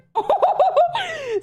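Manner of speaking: high-pitched, long hooting laugh. Real, not acted. Streamer learning whether he replied, bursting into high laughter. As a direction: crack up in a high squeal. Cracks up over reply to post.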